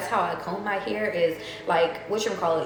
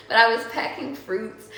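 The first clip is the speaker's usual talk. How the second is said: normal talking voice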